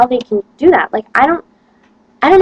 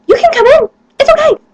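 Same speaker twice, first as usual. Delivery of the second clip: much higher, louder put-on teacher voice; mock foreign teacher; 7 dB louder than usual. Serbian teacher inviting the student in, hammed-up high voice. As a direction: gush at them like an overeager teacher. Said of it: "She goes".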